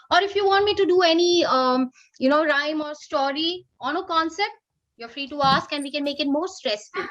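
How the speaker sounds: normal talking voice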